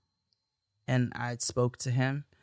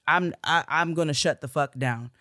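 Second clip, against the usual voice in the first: ordinary talk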